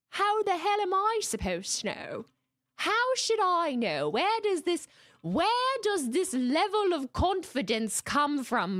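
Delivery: high, dramatic disbelief; theatrically baffled, pitched high; mock exasperation. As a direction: demand it incredulously, drawing out the key words. Mock outrage at viewers' confidence.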